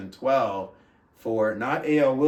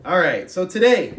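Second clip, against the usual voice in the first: ordinary delivery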